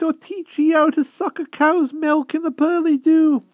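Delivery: very high, coaxing, slow rustic plea; hedgehog voice. A narrator's reading. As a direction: wheedle the badger sweetly. Hedgehog keeps pleading.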